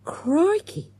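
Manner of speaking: usual speaking voice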